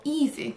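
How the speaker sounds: "easy" stressed on EA; first-syllable stress. Shown as a demonstration. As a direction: hit the opening syllable of "easy". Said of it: "accentué sur la première syllabe".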